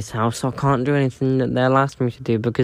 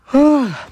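big exasperated sigh; loud sigh; 13 semitones higher, 5 dB louder than usual. Real, not acted. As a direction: heave a loud fed-up sigh. Loud sigh before complaint.

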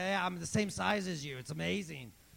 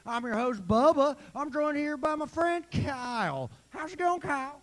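higher, hammed-up country host voice; 3 semitones higher than usual. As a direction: greet the listeners like a folksy DJ. Hosting a country radio show.

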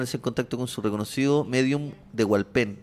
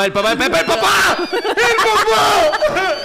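rising to a frantic, much higher, louder shout; panicked yell; 5 dB louder, much slower than usual. Acted. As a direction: scream it out in panic. Panicked shout about killing dad.

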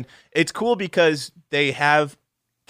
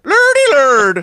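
higher, louder Lordy Lord; mock preacher exclaim; 12 semitones higher, 9 dB louder, much slower than usual. Reacting to Tyler Perry news, mock preacher. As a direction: cry Lurdy lurd in mock awe.

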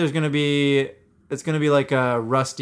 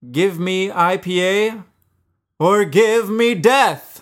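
dramatic beer name reveal; 6 semitones higher, 5 dB louder, much slower than usual. Acted.

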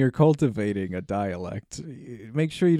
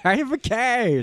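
cat voice; 10 semitones higher than usual. Pretend. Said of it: "Those are our cat voices".

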